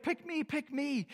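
high, bouncy, eager plea; eager pick me. Retold or imitated. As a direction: beg to be chosen like an excited kid. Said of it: "like Donkey and Shrek".

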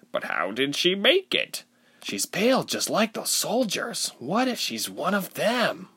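high-pitched, suspicious onlooker; wary villager. A narrator's reading. Villagers doubt the stranger.

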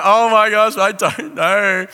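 loud, high-pitched comic wail; hammed-up tearful wail; mock bawling. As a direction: imitate bawling your eyes out, drawing out the end. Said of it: "I just bawled my eyes out".

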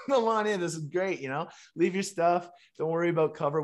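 high, loud, bubbling acted enthusiasm; imitated excited welcome. Doorman learns the guest is Canadian, imitated excited welcome. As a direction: play a doorman suddenly delighted to let you in. Doorman waves him in, retold.

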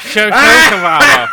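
stretched hammed-up laughing call; drawn-out laughing. Shown as a demonstration. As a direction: drag out the words while laughing. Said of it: "Social, (first plain take)".

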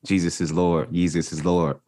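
ordinary talk, nothing marked

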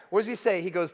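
normal talking voice

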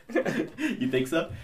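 high, slow, laughing it off. Real, not acted. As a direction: giggle through the question. Laughing at a compliment.

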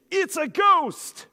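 high, drawn-out startled cry of fright; frightened cry. Retold or imitated. Terrified disciples on the storm-tossed boat, a slow frightened cry. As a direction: gasp it out like you've seen a ghost.

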